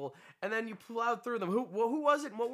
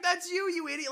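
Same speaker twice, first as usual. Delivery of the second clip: producer chiming in; 8 semitones higher than usual. Retold or imitated. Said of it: "the way he chimes in".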